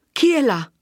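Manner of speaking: high, loud call from indoors; shouted challenge. A narrator's reading. Agnese inside answers a knock, shouting. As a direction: call out sharply to an unknown visitor. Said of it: "gridò da dentro la voce d'Agnese".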